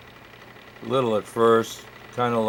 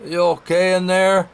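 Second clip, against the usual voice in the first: muffled voice; 6 semitones higher, 4 dB louder than usual. Acted. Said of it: "muffled voice said from the outside".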